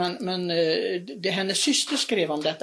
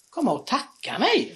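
astonished; 7 semitones higher than usual. Retold or imitated. Astonished reply to ghost.

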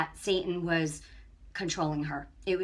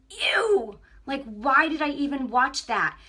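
disgusted ew; 5 semitones higher, 6 dB louder, slower than usual. Retold or imitated. Disgust at the video.

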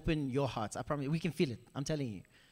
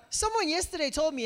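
ordinary talk

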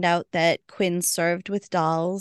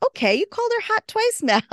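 much higher, pointed mock-jealous jab; 3 dB louder, faster than usual. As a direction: tease her like a jealous girlfriend. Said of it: "jealous Waverly's like".

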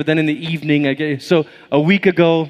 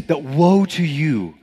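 slow, grave pronouncement; solemn prophetic woe; slower than usual. Retold or imitated. Prophet pronouncing woe on nation, slow grave. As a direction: intone it like a prophet. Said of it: "he says".

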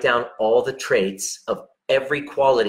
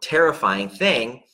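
usual speaking voice

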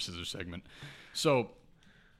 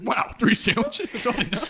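mock disbelief; 12 semitones higher, 7 dB louder than usual. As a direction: act scandalized, high and loud, nearly laughing. Mock amazement at boss's remark.

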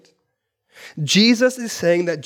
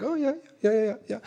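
fake understanding; 4 semitones higher, 7 dB quieter, much faster than usual.

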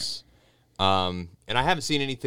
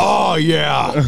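higher, much louder hammed-up yell; mock shout; 7 semitones higher, 8 dB louder than usual. Reacting to a friend's fart joke, mock shout. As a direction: belt it like a cheering crowd.

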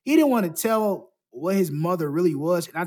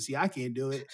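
ordinary talk

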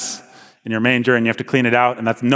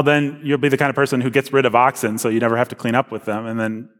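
ordinary delivery

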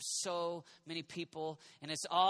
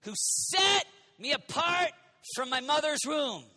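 full-force preaching, louder and higher; 9 semitones higher, 9 dB louder than usual. Acted. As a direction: proclaim it to the whole room. Preaching climax.

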